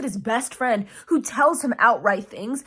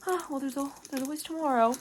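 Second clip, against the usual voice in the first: soft, slightly higher mock-disappointed voice; disappointed mother; 3 semitones higher, 6 dB quieter, faster than usual. Mother let down by her son, mock disappointment. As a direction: act out a softly let-down mother. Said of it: "she gets disappointed and she's like".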